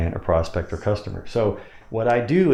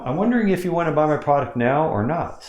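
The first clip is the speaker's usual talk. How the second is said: lifted into a higher pitch; airy high-pitched sales question; high demo line; 7 semitones higher than usual. Shown as a demonstration. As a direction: float the whole question up high. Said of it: "So you can say it like that".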